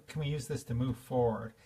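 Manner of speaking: ordinary delivery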